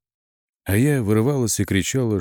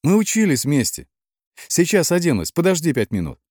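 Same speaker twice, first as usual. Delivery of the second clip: high, raised voice calling down; 9 semitones higher, 3 dB louder than usual. A narrator's reading. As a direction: call to someone outside, urging them to wait. Calling down from window to Chip.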